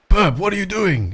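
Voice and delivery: high-pitched German smoker voice; Gilnash. Acted. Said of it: "in his German smoker voice".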